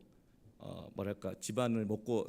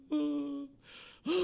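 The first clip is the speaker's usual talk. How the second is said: high, drawn-out mock wail; imitated crying; 12 semitones higher, much slower than usual. Wife fallen on the bed, imitated high wailing. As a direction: mimic long wailing sobs. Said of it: "우는 거".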